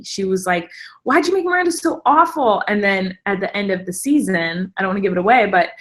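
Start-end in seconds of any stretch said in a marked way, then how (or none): 1.0-2.6 high-pitched, indignant complaint; imitated mad sister. Sister blaming the show's maker, high mock-angry complaint. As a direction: whine the accusation in mock outrage. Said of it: "she was so mad at me".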